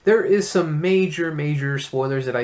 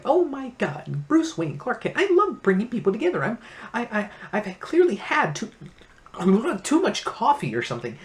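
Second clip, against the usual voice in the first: a much higher, giddy, over-caffeinated Lex; giddy Lex Luthor; 7 semitones higher than usual. Retold or imitated. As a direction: gush like a manic villain. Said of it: "yucking it up there in the corner".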